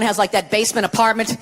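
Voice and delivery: normal talking voice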